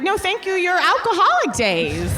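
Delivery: high mock toast voice. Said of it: "It's like".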